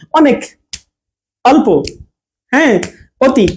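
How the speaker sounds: brief first অ in অনেক, অল্প, অতি; quick অ. Shown as a demonstration. Teacher giving short-vowel examples, quick অ in অনেক, অল্প, অতি. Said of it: "অটা কিন্তু খুব দ্রুত উচ্চারণ হয়ে যায়".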